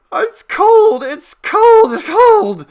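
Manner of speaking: a frightened man shrieking the same words; terrified repeated cry. Acted. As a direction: moan it out three times in terror. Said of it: "like that three times".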